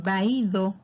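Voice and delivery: VAÍDO very slow, weight on the Í; careful slow sounding-out of vaído; demonstrating accented vowel. Shown as a demonstration. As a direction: stretch vaído so A and Í sound apart. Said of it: "está entre una A y una I".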